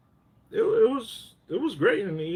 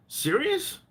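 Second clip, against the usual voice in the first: Serious? shot up high in disbelief; outraged disbelief; 13 semitones higher than usual. Real, not acted. Friend ranting about a speeding ticket, incredulous high squeak. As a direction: pitch leaps up, incredulous. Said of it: "I got so mad".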